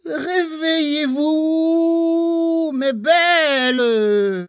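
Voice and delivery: high, slow calling out to flowers; crying out. Acted. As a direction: call them awake, drawn out. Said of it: "s'écria-t-il".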